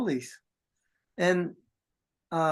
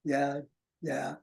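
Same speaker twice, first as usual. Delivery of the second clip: imitated police officer; 4 semitones lower, 6 dB quieter than usual. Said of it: "One guy's just sitting there like".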